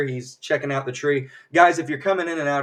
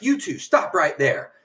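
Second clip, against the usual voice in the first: higher, slow, measured order; mock stern command; 4 semitones higher, 4 dB louder than usual. Organizer halts two people sneaking out, mock stern command. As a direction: halt them like an official.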